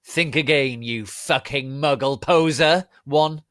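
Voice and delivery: loud, high defiant retort; defiant yell. Acted. Harry defying Voldemort, loud defiant yell. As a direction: hurl the insult back. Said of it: "Harry yelled".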